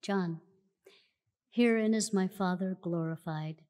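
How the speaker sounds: normal talking voice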